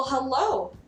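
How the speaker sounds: ordinary talk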